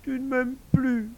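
high, loud wounded wail; hammed-up weepy wife; tearful reproach. A narrator's reading. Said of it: "en tamponnant ses yeux du coin de son mouchoir".

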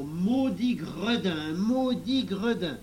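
crié fort et lent, « Maudit gredin » martelé; cri de grand-mère. Acted. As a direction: gronder le gamin à pleine voix, lentement. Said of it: "en criant". (la grand-mère crie fort et lentement en tirant l'oreille d'Émile)

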